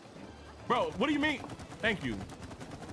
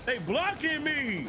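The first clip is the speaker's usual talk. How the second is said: frustrated whine; 5 semitones higher, much slower than usual. Real, not acted. Enemies block his shots.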